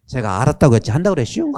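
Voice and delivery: fast, laughing Chungcheong comeback; Chungcheong accent, laughing. Retold or imitated. Chairman cheekily answering the priest in a laughing Chungcheong accent. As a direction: toss it back quickly through a laugh. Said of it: "회장님이".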